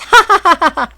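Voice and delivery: high loud burst of laughter; loud childish laugh. A narrator's reading. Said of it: "the boy laughed again".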